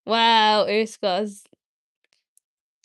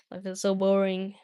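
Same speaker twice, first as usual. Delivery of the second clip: normal talking voice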